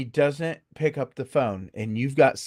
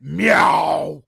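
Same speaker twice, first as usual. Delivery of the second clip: loud scary meow; 6 dB louder than usual. Retold or imitated. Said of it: "and I went".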